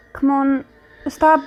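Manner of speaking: ordinary talk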